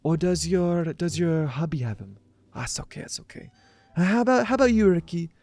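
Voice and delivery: high-pitched, loud put-on woman's voice; impersonating Ashley. Killer posing as Ashley greets her husband in a high, sweet voice. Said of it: "I just switch the pitch. My voice is hers".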